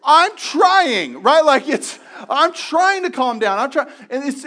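high, loud exasperated pleading; an anxious person pleading to be understood; frustrated. Acted. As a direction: vent the frustration of trying. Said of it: "it's the most frustrating thing".

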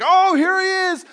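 high, slow, delighted cry; a father spotting his lost son far off; grandpa's overjoyed high greeting; joyful recognition. Retold or imitated.

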